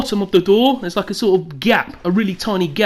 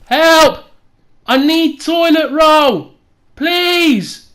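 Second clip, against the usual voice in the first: higher, louder, slow call for help; retold shout for help; 5 semitones higher, 5 dB louder, much slower than usual. Stuck on the toilet calling out to whoever's home, pleading shout. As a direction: call through the door, pleading. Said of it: "I was literally shouting".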